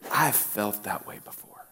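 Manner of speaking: soft unvoiced whisper; quiet whisper to yourself; confessional whisper. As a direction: whisper it like a private admission. Admitting an inner thought.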